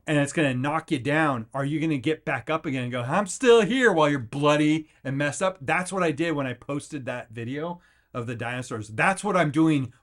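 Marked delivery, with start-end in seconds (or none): 3.0-3.9 high, loud defiant cry; defiant shout. Pretend. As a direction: shout it back at the world. Defiant cry while bloodied.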